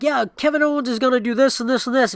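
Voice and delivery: hammed-up writers pitching ideas; mock eager writers. Writers pitching to Vince, mock eager. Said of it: "five writers are like".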